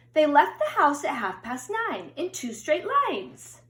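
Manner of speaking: storybook read-aloud, gentle and lilting; children's picture book narration. A narrator's reading. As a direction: read it like bedtime story time.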